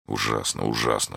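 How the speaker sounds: low, soft, horrified near-whisper; hushed murmur. A narrator's reading. Athos over the poisoned soldier, hushed horror. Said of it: "шептал Атос".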